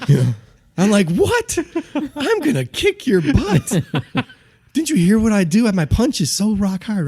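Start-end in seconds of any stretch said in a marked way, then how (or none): 1.1-3.8 very high, incredulous squawk; hammed-up, high-pitched disbelief; cocky outrage. Acts cocky self, outraged. 4.7-7.1 high, incredulous brag; mock-indignant, pitched well up; cocky boasting. Acts cocky self boasting.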